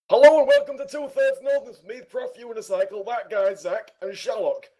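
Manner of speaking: high, loud show-host intro; announcer voice. Acted. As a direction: belt the intro out like a presenter. Another intro take.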